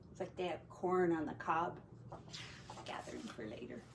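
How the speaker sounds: hushed quick comment; quiet aside. Real, not acted. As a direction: murmur it to the listener. Hushed aside about picture.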